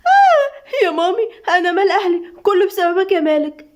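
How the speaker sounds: high-pitched, wailing fright; frightened. A narrator's reading. Doaa clinging to Khaled, blaming Malik, frightened. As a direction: open on a long high yelp, then whine fearfully. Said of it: "دعاء بخوف وهي بتحضن خالد".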